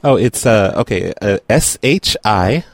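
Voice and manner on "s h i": slow, letter by letter; dictating. Real, not acted. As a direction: spell it out clearly, one letter at a time. Reads out the password letters.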